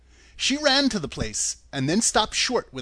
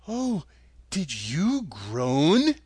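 Dorothy voice; much slower than usual. Acted.

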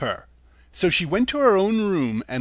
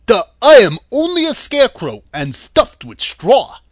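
louder, higher earnest straw man; brave scarecrow; 3 semitones higher, 8 dB louder than usual. A narrator's reading. Scarecrow bravely asking the lady for brains, louder and higher. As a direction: answer up bravely and loud. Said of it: "he answered her bravely".

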